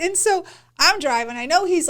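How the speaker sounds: ordinary talk, nothing marked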